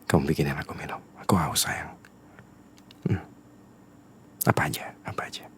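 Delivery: unvoiced, gentle whisper; pleading whisper. Boyfriend whispering to girlfriend why he must work, pleading whisper. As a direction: whisper the excuse to win her over. Asking girlfriend to make a drink.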